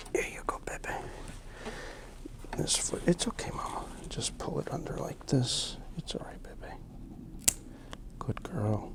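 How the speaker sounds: whispered reassurance to a dog; soothing whisper. Real, not acted. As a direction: breathe the words softly, calming. Whispered soothing while trimming.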